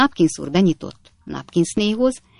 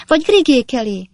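much higher and louder cry; anguished cry; 8 semitones higher, 6 dB louder than usual. Acted. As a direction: cry out in despair. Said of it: "kiáltotta Napkins kisasszony".

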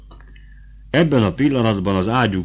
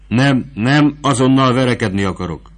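higher, louder grim protest; dark cry; 6 semitones higher, 7 dB louder than usual. Acted. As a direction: refuse her, grim and stubborn. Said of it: "kiáltotta hősünk sötéten".

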